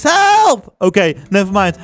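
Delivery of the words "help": high-pitched acted yell; cry for help. Son vanishing in the school toilet, high cry for help. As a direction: yelp it out high and sudden.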